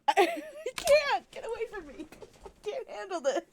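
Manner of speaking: very high, overwhelmed squeal; flustered squealing. Real, not acted. Streamer overwhelmed by a character up close, flustered squealing. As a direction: squeal in giddy panic, voice way up.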